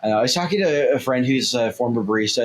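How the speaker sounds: ordinary delivery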